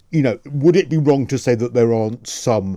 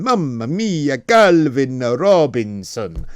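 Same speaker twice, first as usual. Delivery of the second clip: hammed-up Italian accent, drawn out on the name; much slower than usual. Radio host introducing a guest, mock Italian accent. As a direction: put on a playful stage-Italian accent. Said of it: "I'm putting on, you know, an Italian accent".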